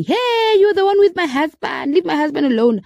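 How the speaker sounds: high-pitched mock yell, falling as it goes; retold shouting. Wife accusing a stranger over her husband, retold shouting. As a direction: imitate an angry wife's yell, pitched high. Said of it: "there's a woman shouting".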